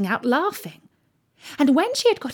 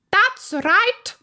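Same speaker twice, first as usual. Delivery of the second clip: high, imperious royal shout; 12 semitones higher, 4 dB louder than usual. A narrator's reading. Queen answers her soldiers, shouting high. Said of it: "shouted the Queen".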